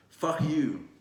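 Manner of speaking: sudden high, loud "fuck you"; a quick shouted insult at the critic; angry outburst. Acted. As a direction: spit it out as a loud retort.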